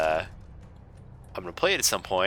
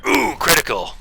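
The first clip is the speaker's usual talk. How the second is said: loud high excited shout; 7 semitones higher, 7 dB louder than usual. Real, not acted. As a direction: shout it out in triumph. Landing a critical hit.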